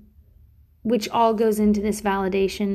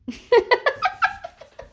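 high giggle. Real, not acted. Giddy laugh while hiding.

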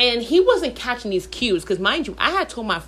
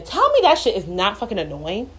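normal talking voice

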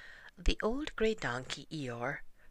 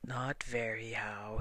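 lower, slow mopey donkey; gloomy Eeyore voice; 3 semitones lower, slower than usual. Acted. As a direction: drag it out like a glum old donkey.

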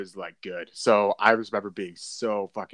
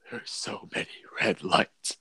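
slow, whispery disbelief; hushed stoned awe. Retold or imitated. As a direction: imitate being baked and awestruck, almost voiceless. Acting himself stoned at red lights.

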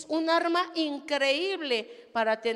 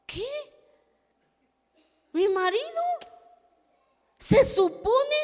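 outraged disbelief; 12 semitones higher, 7 dB louder than usual. Real, not acted. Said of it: "outraged disbelief at husband".

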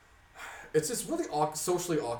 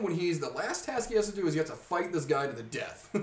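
ordinary delivery

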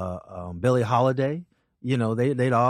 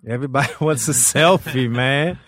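laughing through the words, louder; 6 dB louder than usual. Real, not acted. Comic agreeing with his host about selfies, laughing. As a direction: echo it with a laugh breaking in. Amused echo of the other host.

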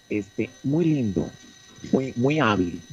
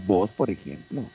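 ordinary talk

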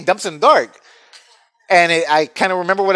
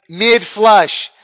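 ordinary talk

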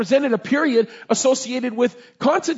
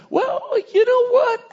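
scheming prophet; slower than usual. Retold or imitated. Balaam's scheming reply.

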